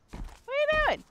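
very high, stretched falsetto question; squeaky falsetto jeer. Acted. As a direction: squeak it way up high to mock him. Repeated jeer at the monster.

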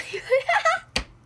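shrill burst of embarrassed laughter; giggly high squeal. Real, not acted. Self-satisfied over sweet line.

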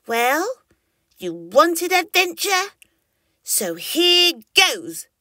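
little dinosaur voice. A narrator's reading. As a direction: talk yourself into it, slowly. Squirt voiced to himself.

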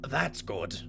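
put-on manly voice. Real, not acted. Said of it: "Trying to be more manly".

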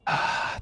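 soft sigh of relief; relieved sigh. Pretend. Captive just freed by trooper, soft relieved sigh. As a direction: let out a soft, relieved breath.

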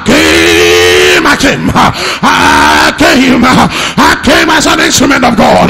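building preacher's declaration. Acted. Preacher declaring deliverance to the church, building to shouts. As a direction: climb steadily until you're declaring it.

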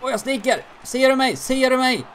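high, loud shout for the pass; excited calling out. Real, not acted. Gamer shouting at his teammates for a pass. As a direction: yell to be seen, demanding the puck.

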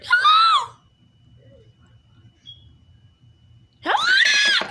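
very high calling out; squeaky call. Acted. Squeaky dad calling hello very high.

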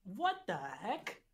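mildly affronted film star; lightly put-on actor voice, questioning; a touch of celebrity indignation; Ray Liotta. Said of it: "yes, I am Ray Liotta".

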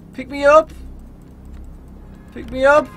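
high, loud, rising pleas; pleading call. Acted. Begging cars for a lift.

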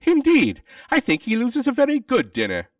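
very high, loud exclamation; high, emphatic acted objection; indignant interjection. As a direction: burst in loudly, pitched right up.

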